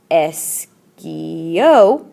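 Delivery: esquío stretched very slowly with the stress clearly on the í; teacher slows down so students hear the accent on esquío; careful esquío. Shown as a demonstration. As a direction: draw out esquío and lean on the accented í. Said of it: "don't forget that accent".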